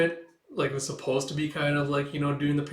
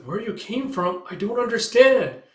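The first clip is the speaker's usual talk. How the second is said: high, loud, panicked question; high-pitched frantic bewilderment; bewildered cry; 8 semitones higher, 6 dB louder than usual. Acted. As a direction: demand it in acted alarm. Bewildered character questions the creature.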